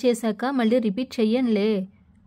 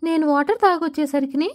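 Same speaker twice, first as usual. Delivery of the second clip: put-on lightly raised little girl voice, relieved; a slightly higher, cheerful little girl; Mini's voice; 7 semitones higher than usual. Doll voice for Mini.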